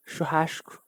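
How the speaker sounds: churrasco with a hushing "sh" before the c; strong Rio S. Shown as a demonstration. Streamer showing chat how Rio says Churrasco, strong hushed S. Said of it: "with a very strong ass".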